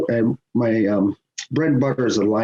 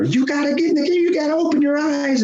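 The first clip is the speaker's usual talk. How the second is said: high mock yell at the partner; hammed-up heckle in a high voice; imitated yelling. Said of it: "he's yelling at my partner".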